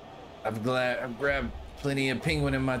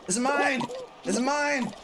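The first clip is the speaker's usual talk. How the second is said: possessive whining; slower than usual. Real, not acted. Clutching penguin, refusing others.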